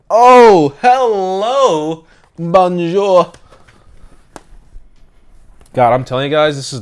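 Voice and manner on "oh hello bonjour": excited. Real, not acted. Greeting the device playfully.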